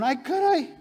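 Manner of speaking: high, loud mock alarm; startled exclamation. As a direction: exclaim it like a startled onlooker, played for laughs. Acts out startled synagogue crowd. (exclamación de susto fingido)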